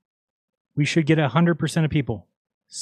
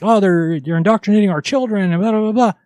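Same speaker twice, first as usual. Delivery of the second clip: higher, louder mock outrage at his old self; mock upset self; 6 semitones higher, 3 dB louder than usual. Mocking how he used to fret about indoctrination, mock outrage. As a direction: parrot his former panic, pitched up and loud. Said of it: "I was like".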